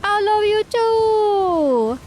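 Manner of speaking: very high, very slow, loud I love you too; hammed-up loving reply; gushing reply. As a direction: stretch it out in a delighted squeal. Answering the fake broadcast.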